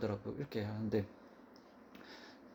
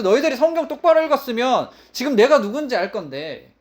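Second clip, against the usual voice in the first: rebuking Jesus; 6 semitones higher, 5 dB louder than usual. Acted.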